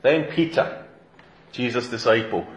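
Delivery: normal talking voice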